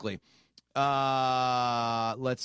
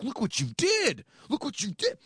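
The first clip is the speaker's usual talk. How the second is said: lightly voiced Connery as Indy's father, high and wounded; Sean Connery impression; 10 semitones higher than usual. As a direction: mimic the dad's pained reproach.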